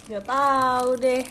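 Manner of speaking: slow, loud shrug still full of laughter; laughing it off. Real, not acted. Dodging the viewer's question about Kak Iki, still laughing slowly. Answering through leftover laughter.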